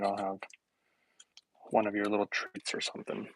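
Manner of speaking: whispered grudging give-in; whispered aside. Retold or imitated. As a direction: hush it like a secret concession. Giving in to proud mother.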